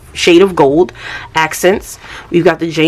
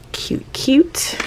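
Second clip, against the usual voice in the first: whispered squeal; 10 semitones higher, 7 dB quieter than usual. Real, not acted. Gushing over paper clips.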